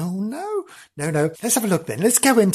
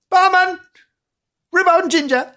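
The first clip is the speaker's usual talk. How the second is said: called out higher and louder; calling barman; 11 semitones higher, 4 dB louder than usual. Pretend. Presenter ordering from the barman, called out brightly. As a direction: hail the barman across the bar.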